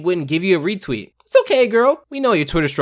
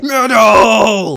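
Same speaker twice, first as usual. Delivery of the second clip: imitating metal shout; 4 semitones higher, 7 dB louder than usual. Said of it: "one more time".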